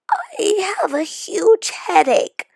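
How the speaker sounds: put-on high, whimpering child voice; whiny child. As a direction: whimper it like a kid in pain. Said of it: "says Penny".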